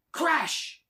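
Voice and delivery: shouted out big and high; loud crash. A narrator's reading. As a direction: call out the crash like a sudden boom. Tree crashes down in story.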